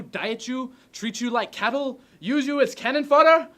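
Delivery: high, loud, impassioned shouting; ranting dictator speech. Acted. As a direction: rouse the soldiers with full force. Rehearses climax of speech.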